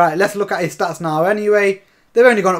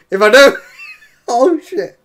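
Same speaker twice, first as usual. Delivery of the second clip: nervous laugh; 4 semitones higher, 4 dB louder than usual. Real, not acted. Scared of losing, laughs.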